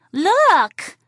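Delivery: put-on high, loud child's cry of look; a small boy pointing and calling out; little boy voice. Boy calls to his mom in a high loud child voice.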